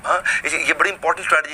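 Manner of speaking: ordinary talk, nothing marked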